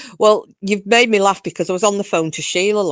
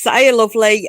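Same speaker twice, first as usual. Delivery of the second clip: Cockney greeting; 3 semitones higher, 6 dB louder than usual. Retold or imitated. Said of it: "Cherokee girl is".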